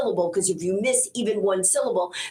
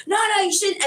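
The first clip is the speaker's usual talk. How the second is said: husband's voice; 12 semitones higher than usual. Retold or imitated. Husband quoted teasing.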